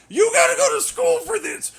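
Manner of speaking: high, very loud sarcastic yell; shrieking indignant mockery; mock outraged shout. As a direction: scream it as a taunt. Mocking critics who demand credentials.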